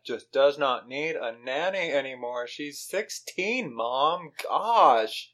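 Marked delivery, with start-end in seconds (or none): 2.5-5.3 hammed-up teenage exasperation; exasperated teenager. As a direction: stretch it out, fed up with a parent. Voices exasperated teen at mother.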